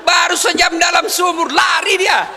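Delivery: loud, laughing punchline shout. Real, not acted. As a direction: hit the punchline loud for the crowd.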